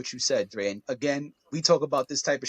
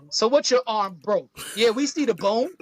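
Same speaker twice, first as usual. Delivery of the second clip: raised, higher-pitched jeer at an injured man; hammed-up no-sympathy coach; mock tough-love scolding; 5 semitones higher than usual. Mocking tough-guy reaction to injury.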